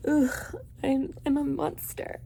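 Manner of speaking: whiny, self-pitying brooding voice; mock angsty vampire. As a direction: ham up the tortured monster. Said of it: "you're over here like".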